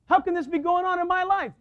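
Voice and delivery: high, loud acted outcry; protesting complaint. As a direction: wail it at God in mock exasperation. Acting believer complaining to God.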